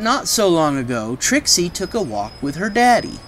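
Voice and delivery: plain reading voice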